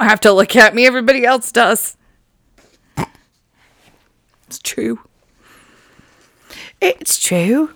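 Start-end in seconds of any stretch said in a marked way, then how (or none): 4.5-5.0 unvoiced whispered take; whispering. Repeats line, first whispered. 6.8-7.7 raised, sing-song take; high playful voice. Pretend. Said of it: "I'm just mimicking you".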